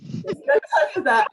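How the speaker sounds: laughing, high and loud; amused. Real, not acted. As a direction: laugh through the words as you echo the heckle. Laughs at host's heckle about time.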